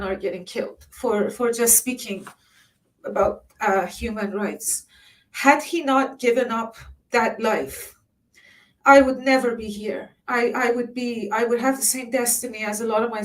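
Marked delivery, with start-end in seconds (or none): none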